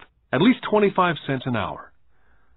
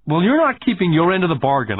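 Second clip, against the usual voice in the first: put-on higher-pitched blurted protest from a hurt boy; upset boy's raised-pitch complaint; nearly in tears; 7 semitones higher, 4 dB louder than usual. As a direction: blurt it out, upset, as the narrated child. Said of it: "nearly in tears".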